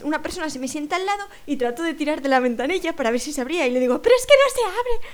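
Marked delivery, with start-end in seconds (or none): 4.0-5.1 exasperated. Retold or imitated. Tries window, tells woman.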